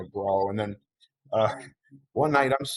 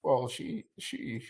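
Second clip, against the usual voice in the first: emotional; 3 semitones higher, 6 dB quieter, much slower than usual. Real, not acted. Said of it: "I'm such an emotional Italian".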